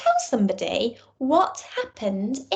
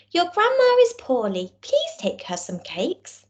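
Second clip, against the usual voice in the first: lightly voiced mother, first line higher; a hint of a fussing mum; mother's voice; 7 semitones higher than usual.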